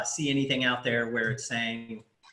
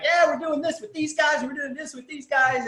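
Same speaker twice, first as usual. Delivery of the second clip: high-pitched gushing imitation of a boast; mock excited poster; 13 semitones higher, 4 dB louder than usual. Host mimics the post about fake partnerships, mock excited. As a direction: imitate an over-eager announcement, pitched way up. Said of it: "It was like".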